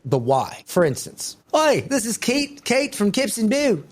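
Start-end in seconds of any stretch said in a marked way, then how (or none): 1.5-3.8 a hammed-up try at an Australian accent that doesn't land; failed Australian accent. Shown as a demonstration. Pitching the brand video to Kate in a failed Australian accent. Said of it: "I can't do an Australian accent".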